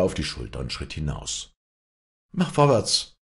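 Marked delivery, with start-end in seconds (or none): 2.3-3.2 barked order, high and loud; harsh command. A narrator's reading. Walli orders the boy to hurry, a harsh loud command. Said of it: "herrschte sie mit rauer Stimme den Buben an".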